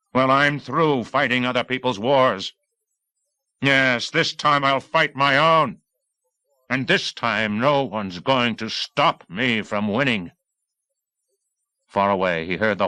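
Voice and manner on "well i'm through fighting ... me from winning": fierce vow. Acted. Vow to fight his own war.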